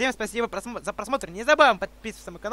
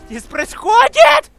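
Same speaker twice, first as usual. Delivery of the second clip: baffled shout; 11 semitones higher, 11 dB louder, slower than usual. Real, not acted. Baffled yelling at chaos.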